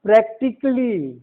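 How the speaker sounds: "प्रैक्टिकली" as prak-tik-lee; silent A. Shown as a demonstration. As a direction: drop the a before "ly" in "प्रैक्टिकली". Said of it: "प्रैक्टिकली नहीं".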